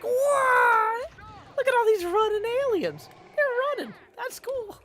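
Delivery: high, loud gushing wonder; wide-eyed excited. Retold or imitated. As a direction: gasp at every shiny thing. Voicing his distracted self.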